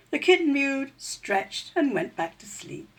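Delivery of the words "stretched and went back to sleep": usual speaking voice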